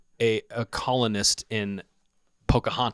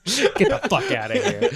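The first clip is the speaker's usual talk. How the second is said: laughing dismissal; 11 semitones higher than usual. Real, not acted. Mocking own pedantry, laughing.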